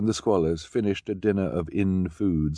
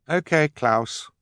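put-on higher, slow girlish; young girl Violet; 9 semitones higher, 3 dB louder, slower than usual. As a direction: gently prompt him. Violet starts.

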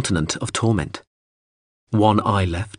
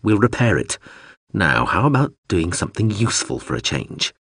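plain reading voice

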